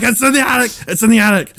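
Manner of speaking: put-on high, insistent child's plea; panicked kid. Scared son tells dad about the claw, high panicked pleading.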